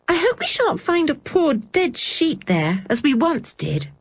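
put-on high girlish voice, a touch of a shudder; little girl half-dreading what they'll find; young girl with a shudder. A narrator's reading. Anne telling the others, high and a little uneasy. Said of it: "said Anne with a shudder".

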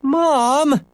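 high, drawn-out whine; wailing. A narrator's reading. Boy protesting to his mother, mock wail. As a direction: wail it high like a thwarted child. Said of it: "Henry wailed".

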